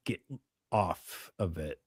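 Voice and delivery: normal talking voice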